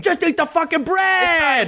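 high, slow yelling rant; screaming comic rant. Pretend. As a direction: rage at bread like an angry comic. Continuing the screaming comic bit.

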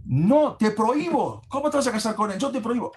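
high, stern father forbidding it. Acted. Father forbids his daughter to marry Akiva, high and stern. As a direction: lay down the law, outraged.

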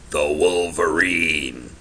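low, drawn-out showman's intro; dramatic announcement. Acted. Host introducing his hero to listeners, dramatic drawn-out announcement. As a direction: announce it slowly, savouring each syllable. Hyped introduction of interview guest.

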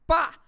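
very loud, high "pá!"; sudden pop sound. Retold or imitated. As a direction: imitate a sudden burst. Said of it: "Ela fez assim". (estalo súbito, bem alto)

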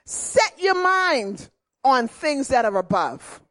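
ordinary talk, nothing marked

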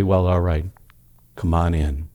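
God the Father admitting a soul, low and soft; the Father's voice. Acted. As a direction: let him in, low and calm. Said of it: "And the Father will say".

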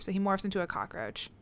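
ordinary delivery

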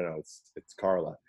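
ordinary talk

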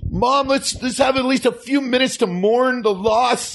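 put-on high, pleading kid voice; upset boy John. John begging his mom for time to mourn, upset kid voice. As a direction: plead like a grieving young son. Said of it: "you can be John".

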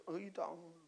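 very soft, small-voiced question; acted timid disbelief. Gideon answering the angel's greeting, softly disbelieving. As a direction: drop almost to nothing, as if doubting you're meant.

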